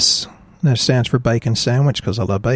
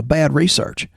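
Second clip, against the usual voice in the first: normal talking voice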